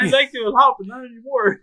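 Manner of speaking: high, loud, betrayed. Retold or imitated. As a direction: turn on him, hurt. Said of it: "that's what I was like".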